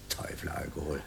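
soft, low, unvoiced whisper; a hushed, ominous whisper; whispered refrain. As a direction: breathe it like a dark secret. Refrain said under the breath.

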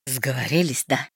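low, bitter reproach; wounded bitterness. Acted. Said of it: "с горечью спросил Марстон".

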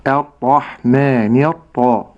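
الرحمن with a thin light opening hamza, slow; light hamza demo. Shown as a demonstration. Sheikh showing the light hamzat al-wasl, slowly. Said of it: "وتكون مرققة".

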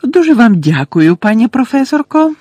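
high, loud squeaking little professor; squeaky thanks. A narrator's reading. Flitwick thanks the professor for her help, squeaking. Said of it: "пропищав професор Флитвік".

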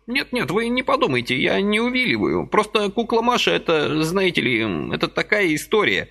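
put-on high fussy old man's voice, gently reproaching; a little old man chiding kindly; old man reproaching Vasily for his haste, high and fussy; reproachful old man. A narrator's reading.

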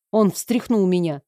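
ordinary delivery

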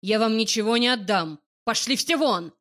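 put-on loud drunken raging yell; furious shout. A narrator's reading. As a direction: rage at everyone. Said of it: "кричит он в ярости".

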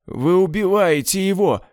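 отчаянный крик, выше обычного; кричи в ужасе; закричал. A narrator's reading. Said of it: "закричал Айзек". (Айзек кричит на убийц брата, выше)

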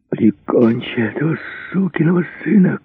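high, strained cry without much volume; shouted from captivity. A narrator's reading. Captive cries to his rescuer from behind bars, urgent high shout. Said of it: "крикнул из-за решётки Бэнкс".

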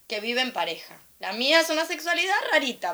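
ordinary talk, nothing marked

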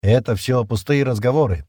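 ordinary delivery, nothing marked

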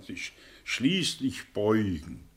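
ordinary delivery

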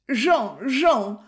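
a touch of tearful pleading; near tears. A narrator's reading. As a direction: call his name, close to crying. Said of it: "commença madame Robineau près des larmes".

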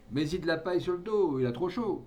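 father calls out to the coachman; calling to the coachman. A narrator's reading. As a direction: call it across the yard.

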